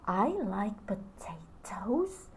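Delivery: slow whisper with a rise on like; whispered doubtful question. Shown as a demonstration. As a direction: breathe it out, unsure and surprised. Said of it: "I'm acting out".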